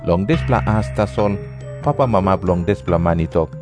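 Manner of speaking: normal talking voice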